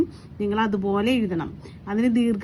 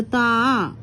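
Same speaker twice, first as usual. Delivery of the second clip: "താ" held long on its vowel; drawn-out vowel. Shown as a demonstration. Teacher reminding pupils that we lengthen it, drawn-out "താ". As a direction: draw out the "aa" of "താ". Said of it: "നീട്ടി പറയല്ലേ നമ്മള്?".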